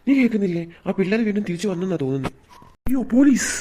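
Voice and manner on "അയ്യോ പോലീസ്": high, loud panicked shout; alarmed cry. Acted. Thief crying out in fright at the police. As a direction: yelp as you spot the police.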